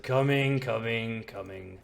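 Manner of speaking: loud call, fading with each repeat; Mikhail. Acted. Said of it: "Mikhail's like".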